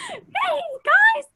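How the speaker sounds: high, loud cartoon Mickey greeting, voice cracking on the name; high cartoon host calling to the kids. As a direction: squeak out a hammed-up Mickey hello.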